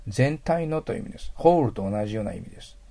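ordinary talk

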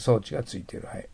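usual speaking voice

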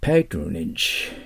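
patronage said very slowly as PAY-tro-nij; pronunciation demo. Shown as a demonstration. Teacher modelling how to say patronage. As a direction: drag out patronage, stress on the first syllable. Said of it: "発音気をつけてください".

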